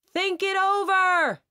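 very high, slow drawn-out call; calling from the rocks to her, high and slow; shouted. A narrator's reading. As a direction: stretch it out across the pond. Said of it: "he shouted to me".

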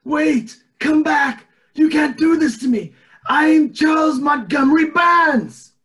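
high, loud frantic yelling; old boss shouting in panic as everyone leaves; desperate shout. Acted. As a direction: scream after the departing advisers. Burns losing election, yelling.

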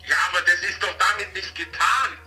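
normal talking voice

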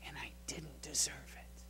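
whispered, overwhelmed with awe; awed whisper. Acted. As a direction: breathe it out in wonder. Awed healed leper speaks.

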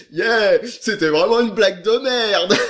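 laughing through the words; chuckling at his own bad joke. Real, not acted.

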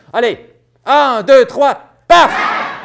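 a slow count building up to a loud, high, breathy paf. Shown as a demonstration. Knight leading children's second try, slow count building to a loud paf. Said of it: "plus fort que ça".

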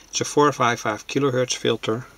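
ordinary talk, nothing marked

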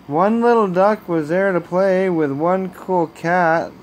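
ordinary delivery, nothing marked

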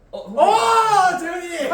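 excited call. Real, not acted. His pick is drawn out.

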